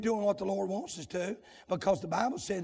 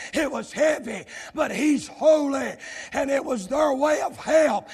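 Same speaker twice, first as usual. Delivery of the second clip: loud revival preaching, building to a shout; shouted sermon cadence; full-force preaching; 5 semitones higher, 11 dB louder than usual. Real, not acted. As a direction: drive each phrase home, louder toward the peak.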